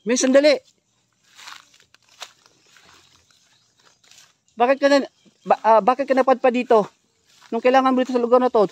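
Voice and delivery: urgent loud calling. Real, not acted. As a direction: raise the voice to reach her. Calling out to the girl again.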